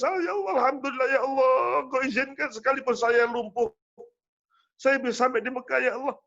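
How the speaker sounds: high, lightly voiced weeping mother; a hint of the old mother crying to God; mother's tearful prayer. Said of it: "ibunya nangis".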